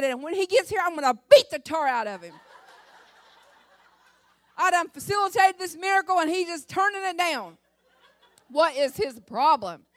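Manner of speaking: loud, high exasperated scolding; angry rant. Retold or imitated. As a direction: vent at the ungrateful son. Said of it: "Oh, I was so mad".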